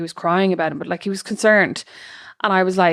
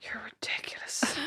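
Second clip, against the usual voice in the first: teasing whisper; 17 dB quieter, slower than usual. Real, not acted. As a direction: whisper it, fond disbelief. Friend teasing mid-conversation.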